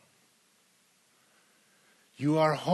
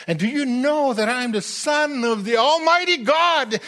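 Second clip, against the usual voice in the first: rising preached proclamation; 9 semitones higher, 8 dB louder than usual. Acted. Preacher proclaiming Jesus' divine sonship.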